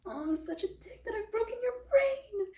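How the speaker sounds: high, wailing self-reproach; mock wail. Olive lamenting she broke Ethan's brain, mock wail. Acts Olive horrified at herself.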